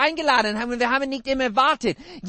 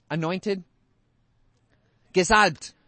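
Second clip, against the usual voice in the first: ordinary delivery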